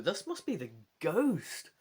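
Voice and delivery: ordinary talk, nothing marked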